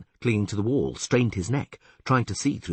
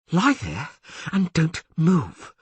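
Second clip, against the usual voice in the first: out of breath, slow; panting; 8 semitones higher, 3 dB louder, slower than usual. Acted. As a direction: pant it out heavily. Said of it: "she advised, panting heavily".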